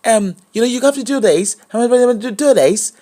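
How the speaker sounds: high put-on coaching voice; imitating Rosie. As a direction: mimic the coach's way of talking. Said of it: "the way she dey talk".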